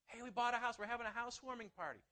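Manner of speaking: high, loud, gushing news; excited announcement. Acted. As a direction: play a proud new homeowner telling everyone. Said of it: "We're so excited about it".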